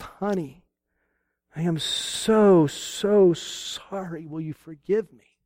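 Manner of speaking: soft, very slow, heartfelt apology; tender apology. Retold or imitated. Husband softly apologizing to his hurt wife, tender and slow. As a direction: let each word of sorry sink in gently.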